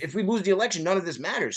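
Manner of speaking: normal talking voice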